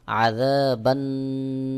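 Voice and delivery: আযাবান্নার drawn out, nasal ন্ন; slow recitation. Shown as a demonstration. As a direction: stretch it slowly. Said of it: "ওয়াজিব গুন্নাহ করতে হবে". (টান দিয়ে আযাবান্নার)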